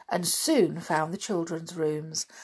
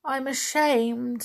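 ashamed; 6 semitones higher, slower than usual. A narrator's reading. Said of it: "said Winnie".

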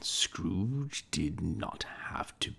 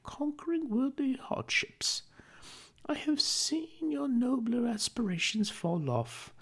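put-on higher, gentle young woman's voice, sadly reproachful; a young woman letting go of her fiancé; Belle, sorrowful. Belle parting from young Scrooge.

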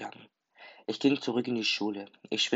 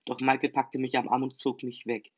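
ordinary delivery, nothing marked

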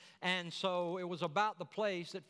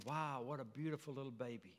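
soft admiring thought; 5 semitones lower, 7 dB quieter than usual. Acted. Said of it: "she's thinking".

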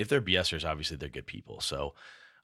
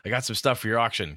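imitating Corey; 5 semitones higher, 6 dB louder than usual. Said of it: "He always comes up and goes".

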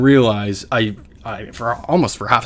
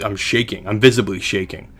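ordinary talk, nothing marked

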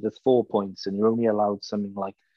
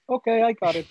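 high mocking voice, much higher; hammed-up carefree answer; mock casual reply; 13 semitones higher, 4 dB louder, faster than usual. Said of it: "and you were like".

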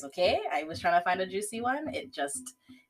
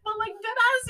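laughing hard; 6 dB louder than usual. Real, not acted. Repeating her friend's quote while laughing, high and strained.

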